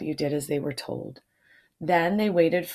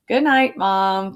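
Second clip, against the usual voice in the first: loud call to Mom; calling out goodnight; 2 semitones higher, 6 dB louder, slower than usual. A narrator's reading. D W calls goodnight down to Mom, loud. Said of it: "called Arthur".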